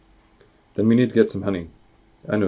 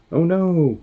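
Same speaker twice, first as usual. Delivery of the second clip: much higher, louder dismayed wail; horrified cry; 10 semitones higher, 5 dB louder than usual. Acted. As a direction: gasp it out in horror. Reacting to bear in goo.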